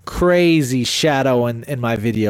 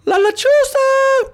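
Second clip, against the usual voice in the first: loud, drawn-out falsetto summons; mock taunting call; 5 dB louder, slower than usual. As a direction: imitate a dad calling out to a spirit. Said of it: "And my dad's going".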